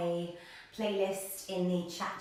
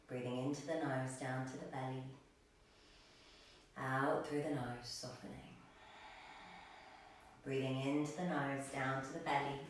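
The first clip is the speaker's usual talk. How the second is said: guided meditation; 2 semitones lower, 3 dB quieter than usual. Real, not acted. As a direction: settle them gently into stillness, breath by breath. Leads breathing and settling meditation.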